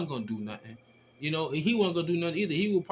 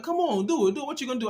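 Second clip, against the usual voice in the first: higher, egging him on; taunting; 8 semitones higher, faster than usual. Retold or imitated. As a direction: dare him to swing, half laughing at him. Taunting the rider.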